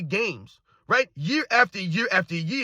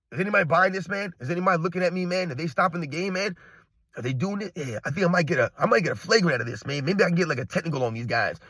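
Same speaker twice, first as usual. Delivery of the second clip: lower, sly voice, scheming to himself; mock LeBron inner voice; 5 semitones lower, 4 dB quieter, faster than usual. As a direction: play the faker plotting a foul call, under his breath. Mocking LeBron's fake injury thoughts.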